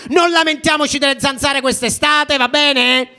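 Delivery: high, loud rabble-rousing speech; mock political rally speech; rally cry. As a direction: rouse the crowd like a protest leader. Comic rally speech to audience.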